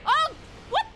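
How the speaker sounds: high, loud disbelief; shocked yell. Real, not acted. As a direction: shriek it in shock. Shocked during the game.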